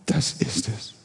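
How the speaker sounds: hushed whisper. Real, not acted. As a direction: drop to a secret whisper. Preacher confides the key point.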